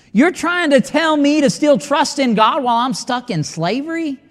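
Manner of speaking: acted indignant disbelief, pitched high; incredulous protest. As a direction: challenge the advice in a high, disbelieving voice. Acts indignant reply of stuck believer.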